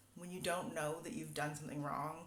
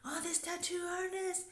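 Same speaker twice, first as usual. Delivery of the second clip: much higher mock outrage; hammed-up indignation, pitched way up; imitated anger at artist; 3 dB louder than usual. Guest blames the tattoo artist, in much higher mock outrage. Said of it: "then angry".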